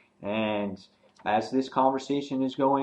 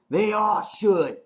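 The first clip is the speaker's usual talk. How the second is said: much higher, slow menacing line; menacing threat; 10 semitones higher, 5 dB louder, slower than usual. Retold or imitated. Nicholas threatening the boy, much higher and slow. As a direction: drag it out like a threat. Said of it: "He goes".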